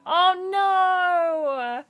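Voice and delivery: long, high "oh no"; dismayed wail. Real, not acted. Streamer told she cannot date her favourite, wailing dismay. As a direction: draw it out in playful despair. Lamenting lost romance option.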